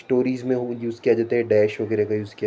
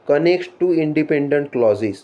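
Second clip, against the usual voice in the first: slow dictation; much slower than usual. Shown as a demonstration. Reading a definition off the slide.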